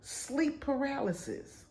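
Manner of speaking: high, soft disbelieving echo; stunned, slow repeat; shocked. Retold or imitated. Reading Google's answer, shocked. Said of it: "And I'm like".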